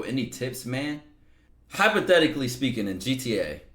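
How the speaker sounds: usual speaking voice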